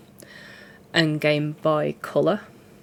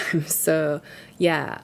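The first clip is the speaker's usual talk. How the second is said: normal talking voice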